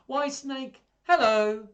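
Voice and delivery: high, loud sing-out hello; chirpy mouse greeting. Acted. Mouse hailing Snake on the path, high and cheery.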